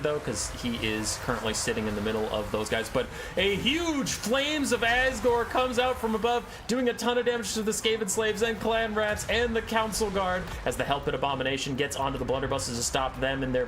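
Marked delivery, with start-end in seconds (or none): 3.4-10.4 excited. Real, not acted. Big hit in battle cast.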